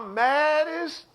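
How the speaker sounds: drawn-out high mock fuming; imitated anger. Voicing angry churchgoers who nearly curse, mock fuming. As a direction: slowly stretch it upward, left hanging. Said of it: "You know, some of y'all".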